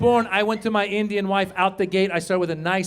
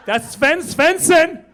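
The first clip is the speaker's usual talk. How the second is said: put-on starstruck child; 7 semitones higher, 6 dB louder, slower than usual. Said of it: "He's like".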